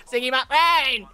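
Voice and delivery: loud delighted shout of the catchphrase; gleeful catchphrase. Pretend. Friend shouting the character's catchphrase back. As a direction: yell it back with glee.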